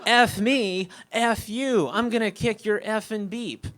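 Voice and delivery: gleeful, high-pitched comeback; enthusiastic retort. Retold or imitated. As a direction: throw out the threats with gusto. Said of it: "responds with enthusiasm".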